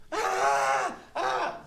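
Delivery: two short mock screams; acted stabbing-victim yelps; imitated cries of pain. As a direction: cry out as if being stabbed. Imitating a man being stabbed.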